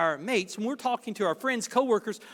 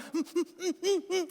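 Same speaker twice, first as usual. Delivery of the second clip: strained eager giggle; 9 semitones higher, 4 dB quieter than usual. Retold or imitated. Listener bursting to talk.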